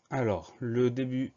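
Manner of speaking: ordinary delivery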